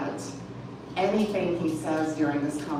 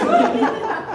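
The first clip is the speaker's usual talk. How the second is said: bright burst of laughter, much higher; real laugh; 6 dB louder than usual. Real, not acted. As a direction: laugh out loud, amused. Reader laughs again.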